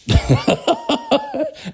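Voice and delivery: high burst of real laughter; big real laugh. Real, not acted. Laughs at own story.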